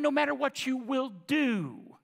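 ordinary talk

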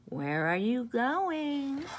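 slow, high, sly fox voice. A narrator's reading. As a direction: draw him in slowly like a fox.

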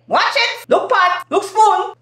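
very high, animated retorts; animated Grenadian. Acted. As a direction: toss out animated Grenadian jibes, voice way up. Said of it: "That is Grenadians. Real animated".

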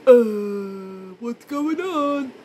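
slow, dumbfounded mock man; drawled what's going on, clueless; clueless boyfriend. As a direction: play the bystander who doesn't get it. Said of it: "our man just stands there like".

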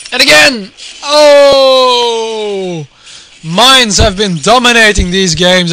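high, loud burst of joy; excited shout. Real, not acted. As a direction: yell as the mine hits. Another mine hit, caster erupts.

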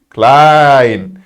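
"Klein" as "kline", low and falling; correct "Klein". Shown as a demonstration. Teacher giving students the right "Klein". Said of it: "Klein değil".

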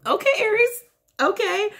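high amused okay; surprised. Retold or imitated. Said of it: "I was like".

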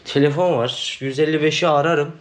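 ordinary delivery

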